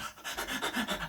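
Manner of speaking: soft, rapid voiceless panting; panicked gasping. Retold or imitated. Patient panicking at A and E, imitated breathless gasping. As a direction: imitate short panicky breaths, unable to catch air. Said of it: "like, I couldn't breathe".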